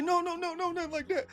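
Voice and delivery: very high, pleading mock protest; frantic denial. Player backpedalling to his offended teammate, high frantic mock denial. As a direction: squeal out an imitation of backpedalling.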